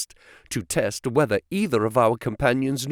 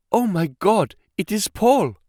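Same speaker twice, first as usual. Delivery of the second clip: sharp gasp, then higher voice; shocked recognition; 5 semitones higher than usual. A narrator's reading. Woman suddenly recognising Paul, gasping in shock. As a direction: gasp and exclaim in startled disbelief.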